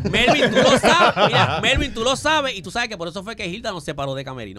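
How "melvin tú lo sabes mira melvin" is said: llamándolo a viva voz, más fuerte, entre risas; llamado en broma. Pretend. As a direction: como si Melvin estuviera en la sala. Le habla en broma a Melvin ausente. (le grita a Melvin, de broma, más fuerte y más lento)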